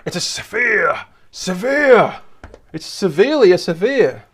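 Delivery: put-on German accent with mounting exasperation; angry German accent. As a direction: ham up a cross German accent on each repeat. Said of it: "my angry German accent".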